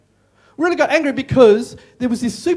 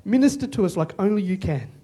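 hushed prayer amen; 2 semitones lower, 3 dB quieter than usual. Real, not acted. Closing prayer.

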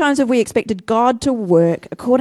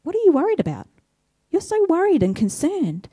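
gently questioning Jesus; 8 semitones higher than usual. Acted. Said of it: "he instead flips it and says".

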